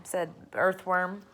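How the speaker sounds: usual speaking voice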